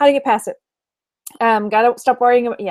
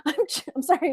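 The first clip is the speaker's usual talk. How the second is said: normal talking voice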